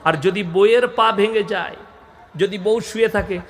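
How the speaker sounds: ordinary delivery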